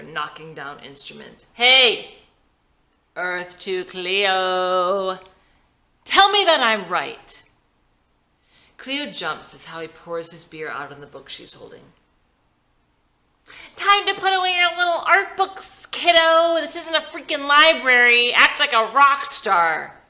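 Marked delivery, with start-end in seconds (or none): 1.5-7.4 loud, brash yell at her; drunken shouting. A narrator's reading. Howie yelling at Cleo over her art book, loud brash shout. 13.7-20.0 loud, high, brash showman voice; rowdy rock star. A narrator's reading. Howie hyping the band before a Chicago show, loud brash rocker. Howie mocks Cleo's art book.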